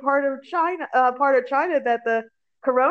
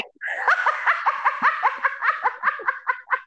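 high laughter; 13 semitones higher than usual. Real, not acted. Laughing at Wu-Tang mix-up.

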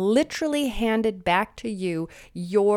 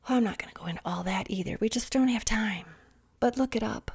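quick hushed stage whisper; fast conspiratorial whisper; whispered aside; 6 dB quieter, faster than usual. Acted. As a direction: toss it off in a whisper. Hurried aside.

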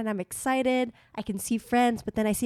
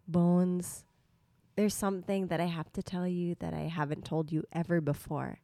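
soft secretive murmur; whisper; 3 semitones lower, 7 dB quieter than usual. Retold or imitated. Groom confiding to bride mid-ceremony, soft hush. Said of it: "Mikael whispers to me".

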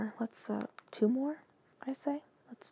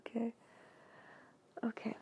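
usual speaking voice

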